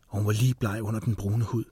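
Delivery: ordinary delivery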